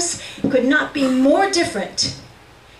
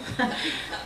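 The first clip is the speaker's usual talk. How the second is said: a quick quiet laugh under the breath; soft breathy laugh; 10 dB quieter than usual. Real, not acted. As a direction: let a small laugh slip out. Laugh after the answer.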